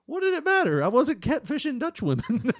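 indignant high yell. Retold or imitated. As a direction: yell it in mock outrage, voice cracking high. His own indignant retort retold.

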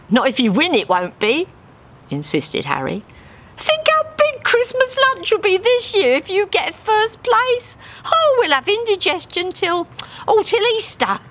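high persuasive cockney duck; Harry's voice. Acted. Harry talking up the prize to Dave, high and coaxing. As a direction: coax him with a grin.

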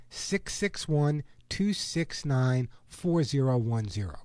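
slow, spaced-out phone number. Real, not acted. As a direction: read each digit slowly, group by group. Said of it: "I'm gonna say it slowly now".

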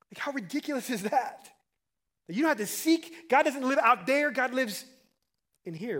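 high, loud pulpit exclamation; marveling, driving sermon delivery; amazed preaching. Real, not acted. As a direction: preach it with incredulous force. Preaches at full force.

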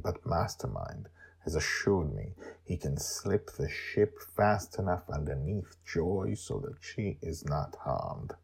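ordinary delivery

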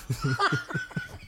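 real high-pitched giggling fit; laughter. Real, not acted. Host amused by his own video, high laughter. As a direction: crack up helplessly at your own joke.

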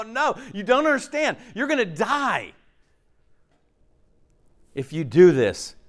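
high and slowing, pleading to be understood; urgent warning. Acted. Believer warning a friend this will kill them, urgent. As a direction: make them grasp the danger.

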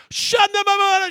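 high, loud tongues burst; imitating the kettle going off in tongues. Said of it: "she just went off".

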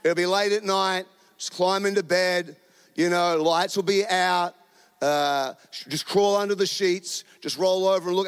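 usual speaking voice

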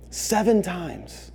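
quiet, slow, each word set down; hushed slow repeat of the number; softly weighted. Acted. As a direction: let the number sink in. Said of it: "slow soft repetition".

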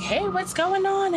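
very high, drawn-out cheery hello; high-energy greeting. Retold or imitated. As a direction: sing it out high, bursting with energy. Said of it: "my high energy self".

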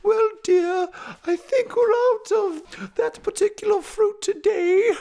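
high, loud fluty mom voice; piping, dear-me housewife; genteel mama. Acted. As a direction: put on a high-pitched wifely voice. Narrator plays Mama Slooverton.

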